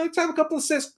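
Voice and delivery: ordinary talk, nothing marked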